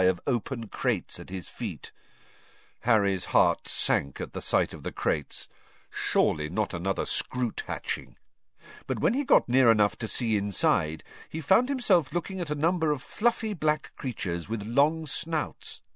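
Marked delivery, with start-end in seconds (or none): none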